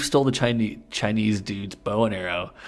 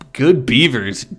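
hammed-up exclamation; 4 semitones higher, 10 dB louder, slower than usual. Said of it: "Pooh said".

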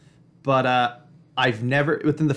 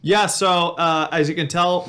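scratchy, shot voice after a loud game; hoarse from yelling; 5 semitones higher, 5 dB louder than usual. Real, not acted. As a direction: voice worn out from booing. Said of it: "my voice is a little shot because I was booing".

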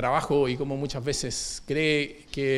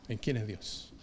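quiet echo; 7 semitones lower, 15 dB quieter than usual. Acted. Preacher echoing it softly to congregation, hushed repeat. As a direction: lower and much softer, trailing away.

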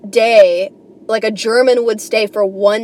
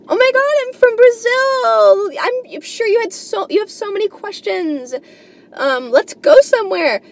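squealing, much higher, gushing hello; mock cheery tourist; 11 semitones higher than usual. Airbnb guest arriving in New York, gushing mock squeal. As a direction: gush like an overeager tourist meeting her host. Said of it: "greeted me like I was probably interested".

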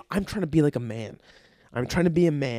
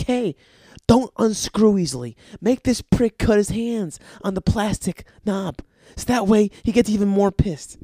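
higher, louder plotting voice; scheming boss; 6 semitones higher, 5 dB louder than usual. Acted. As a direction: give gleeful orders as the pipe's boss. Voices the pipe's imagined boss.

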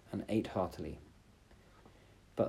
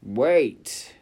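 loud high cry; calling out; 4 semitones higher, 10 dB louder than usual. Acted. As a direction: shout it after her. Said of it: "cried the frog".

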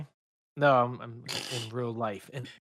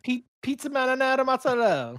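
mock Italian accent; 9 semitones higher, 3 dB louder than usual. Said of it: "in your best Italian accent".

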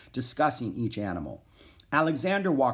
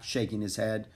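ordinary delivery

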